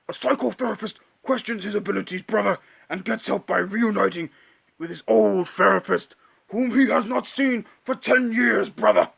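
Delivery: loud, fast raspy wrestler promo roar; Hulk Hogan. Pretend. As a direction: bellow it like a pro wrestler cutting a promo. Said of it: "I'm gonna just read that as Hulk Hogan".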